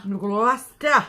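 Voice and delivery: "New Gloucester" boomed out slowly; exaggerated echo. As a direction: playfully parrot "New Gloucester", loud, high and drawn out. Echoes the town name with exaggeration.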